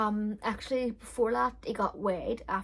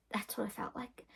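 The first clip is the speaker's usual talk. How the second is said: whispered aside; 9 dB quieter than usual. Real, not acted. Telling viewers about the birth, a soft whisper. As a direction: drop to a breathy whisper.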